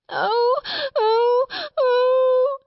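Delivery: high, loud wailing "oh"; child's angry wails. A narrator's reading. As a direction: bawl like a small child in a temper. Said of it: "angry wails of some child".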